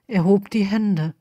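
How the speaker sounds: ordinary delivery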